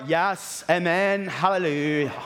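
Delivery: lightly put-on pious praise; a touch of mock churchy piety; church face. Churchgoer stepping out of the car after a fight, mock pious praise. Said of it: "our church face".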